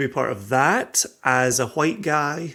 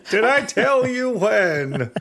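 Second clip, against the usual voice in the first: put-on old British gent; 12 semitones higher than usual. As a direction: ham up a doddering old gentleman. Old colonial gent voice bit.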